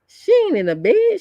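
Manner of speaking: shocked. Retold or imitated. Retelling her shock that Misty isn't in bed, high and stunned. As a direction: say it like you can't believe it.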